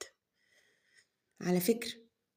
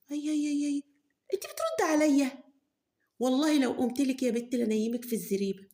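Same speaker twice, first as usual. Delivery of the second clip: mother threatening; 6 semitones higher than usual. Acted. Mother threatens talking-back daughter.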